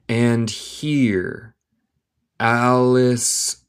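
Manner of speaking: very slow, drawn-out separate words; word-by-word dictation. Shown as a demonstration. Teacher reading Alice to learners, word by word. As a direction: stretch each word and pause after it.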